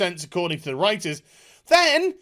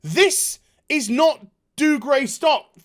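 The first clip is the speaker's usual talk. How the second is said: ordinary talk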